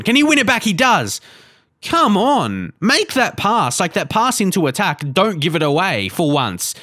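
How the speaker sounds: high, tense urging; will the players forward; urging the team on. Real, not acted. Urging players during attack.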